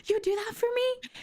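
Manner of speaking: surprised gratitude. Retold or imitated. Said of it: "I was like".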